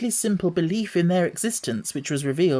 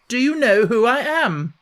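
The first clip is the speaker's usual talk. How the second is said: higher, louder haughty demand; more imperious; 8 semitones higher, 5 dB louder than usual. A narrator's reading. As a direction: press the demand even harder. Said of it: "demanded Colin still more imperiously".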